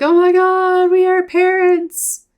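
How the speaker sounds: high, loud, drawn-out mock freak-out; hammed-up overwhelmed cry, held high all through. New father realizes they are parents, freaking out loudly. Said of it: "Look at him freaking out".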